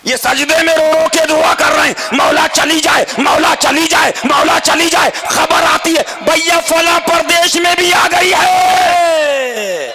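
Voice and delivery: high, chanted preaching cry; full-force preaching. Acted. Preacher mimicking desperate prayers in prostration, high chanted shout. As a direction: build to a long drawn-out final word.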